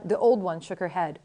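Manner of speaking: plain reading voice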